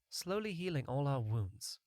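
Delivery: ordinary delivery, nothing marked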